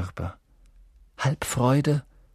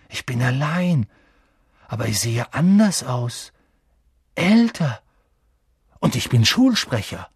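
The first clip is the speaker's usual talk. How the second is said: put-on astonished boy, growing louder; amazed excitement; 3 semitones higher, 4 dB louder than usual. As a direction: marvel at each new discovery. Boy amazed at his mirror image.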